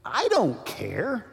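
slow, dismissive shrug of a line; unbothered. Retold or imitated. Pastor told the man was a Satanist, slow and unbothered. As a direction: drag it out like it's nothing. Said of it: "and I said".

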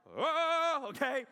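long, loud, high-pitched holler; yelling. Shown as a demonstration. Preacher demonstrating how he starts yelling in worship. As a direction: yell it out with full lungs. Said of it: "yelling, like".

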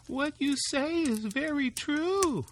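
wife's voice. Acted.

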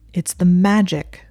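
stern, slow correction; severe. Acted. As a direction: set him straight sternly. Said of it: "her voice severe".

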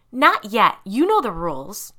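loud, oddly voiced monster scolding; strange voice. A narrator's reading. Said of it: "said in a strange voice".

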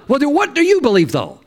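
high, quick acted challenge; challenging comeback. Jesus answering an excuse, acted.

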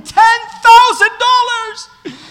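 high, loud, stretched-out celebration scream; crazed winner's screaming. Retold or imitated. Winner runs around his buddy's house, screaming wildly. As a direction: holler it slowly, running wild with joy. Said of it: "ran around his house screaming".

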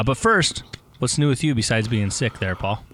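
ordinary talk

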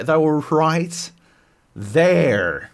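"right there" drawn out and loud; exasperated insistence. Real, not acted. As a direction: stretch "right" and land hard on "there".

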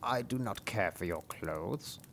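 a slow wet frog speaking up from the well. Acted. Frog in the well speaking to the princess, slow croaky voice.

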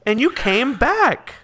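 high, eager townsperson voice; excited townsperson. Acted. Townsperson reassures uneasy returning travelers, high and excited. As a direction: marvel at their return, drawing out the last words.

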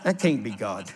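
low, chuckling mock dread; a hammed-up, half-laughing refusal; mock alarm. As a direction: pretend he smells a trap. Balking at the youth pastor offer.

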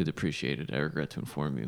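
low, quiet, solemn notice; mock formal announcement. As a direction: deliver bad news like an official.

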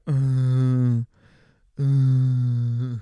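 loud, drawn-out mock moans; hungover moaning.

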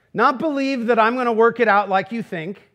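ordinary talk

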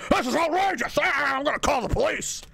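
high, mock-offended squeal; fake outrage. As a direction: play the guilty one crying foul. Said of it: "accuse you of being inappropriate".

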